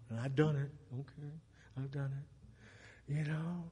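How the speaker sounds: very soft, low admission; quiet confession. Real, not acted. Preacher confessing he has blamed others too, very quiet. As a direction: drop away almost to nothing.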